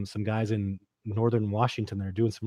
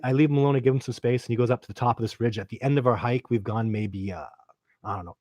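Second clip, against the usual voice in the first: ordinary talk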